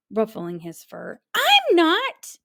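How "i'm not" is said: high, loud grumpy denial. A narrator's reading. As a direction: insist crossly. Jim denies being grumpy.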